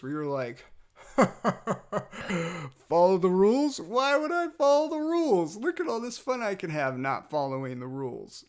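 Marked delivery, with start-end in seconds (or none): 0.7-2.8 loud, high put-on cackle; hammed-up scoffing laughter; mock defiant laugh. 2.9-8.4 hammed-up rebellious kid; defiant little rebel. Acts out defiant toddler against rules.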